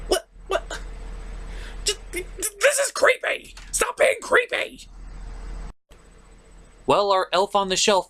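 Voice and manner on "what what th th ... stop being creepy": high shrieking, stammering; freaked out. Acted. A scared sidekick shrieking at the elf to stop being creepy. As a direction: squeal in panic at the creepy elf.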